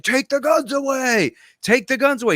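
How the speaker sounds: high-pitched imitation of a screaming rant; hammed-up scream at the camera; mock screaming. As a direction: yell it high, mimicking an outraged host. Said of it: "he's, like, screaming into the camera".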